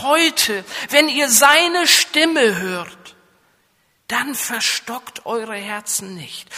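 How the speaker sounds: solemn scripture quote; scripture reading. Acted. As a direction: read the verse out with weight. Quoting scripture in a preaching voice.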